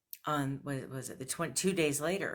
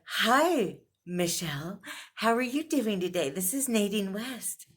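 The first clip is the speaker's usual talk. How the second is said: mock sing-song customer service greeting, higher and louder; imitating an overly cheerful rep; 6 semitones higher, 6 dB louder than usual. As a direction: put on a bubbly call-centre voice. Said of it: "Sounding all cheerful".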